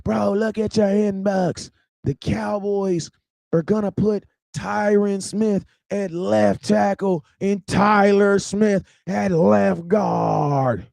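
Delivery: slow, drawn-out big reveal ending in a shout; cousin breaking news. Retold or imitated. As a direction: spell out the news slowly, then shout it. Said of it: "He's like".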